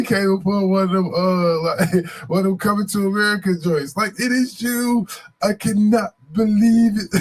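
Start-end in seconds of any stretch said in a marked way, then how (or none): 4.2-7.2 high, slow, awed put-on voice of the crackhead lady; a stranger woman in awe, voice pitched up; mock tearful lady. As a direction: ham up her amazed reunion. Lady recognizing son, voiced.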